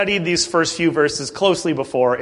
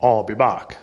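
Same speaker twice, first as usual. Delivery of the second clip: lower, deadpan action-hero line; movie tough guy; 8 semitones lower than usual. Retold or imitated. Preacher quotes Jesus as a deadpan action hero. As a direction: deliver it like a film catchphrase. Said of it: "he said".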